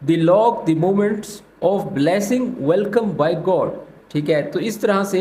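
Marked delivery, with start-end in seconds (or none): 0.1-3.7 very slow, word by word English; very slow translation. Shown as a demonstration. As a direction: drag each word out for learners. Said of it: "तो English में बोलेंगे".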